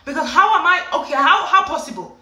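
high, loud, stammering bafflement; baffled disbelief. Retold or imitated. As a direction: sputter in comic disbelief. Baffled outburst at no weight loss.

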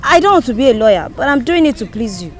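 loud, pleading outburst; frustrated protest. Acted. Daughter protesting father's lawyer dream for her, frustrated. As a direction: push back forcefully at a parent.

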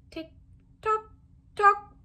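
tick-tock lifted high, rising; tick-tock climbing upward. Acted. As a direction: make the clock wind up, rising. Clock ticking, read aloud.

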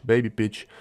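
ordinary talk

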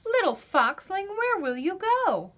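ordinary delivery

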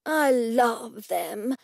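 slow, savoured admiration; delighted exclamation. A narrator's reading. Gallery owner admires the canvases, a slow delighted exclamation. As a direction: draw it out, beaming. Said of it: "Mortimer exclaimed, beaming wide".

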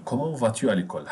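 brisk run-through of the question; quick model question. Shown as a demonstration. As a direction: rattle it off fast. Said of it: "teacher models the question twice".

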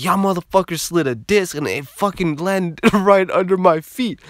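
high, indignant rant with a laugh breaking in; hammed-up angry accusation; loud-mouthed bro complaining in disbelief; imitating outraged friend. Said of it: "He was so fucking pissed".

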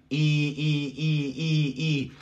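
string of front "ee" sounds for "I"; front I vowel. Shown as a demonstration. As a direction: say "I" like Spanish i, repeated. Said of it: "aquí se pronuncia la I".

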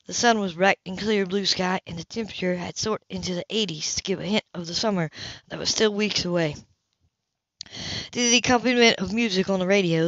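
ordinary delivery

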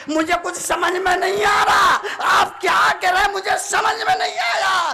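high, loud wifely protest; hammed-up confusion; acted bafflement.

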